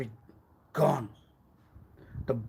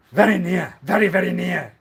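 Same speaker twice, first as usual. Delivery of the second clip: loud, high insistence; 5 semitones higher, 11 dB louder than usual. Acted.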